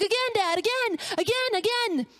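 high, delighted child imitation; excited little girl. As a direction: beg Dad as a thrilled child. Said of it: "and she's excited".

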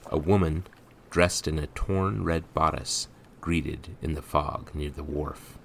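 ordinary delivery

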